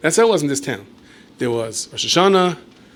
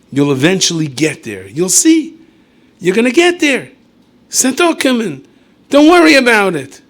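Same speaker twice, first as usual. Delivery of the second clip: rising reassurance; 7 semitones higher, 8 dB louder than usual. Real, not acted. Reassuring the listener loudly.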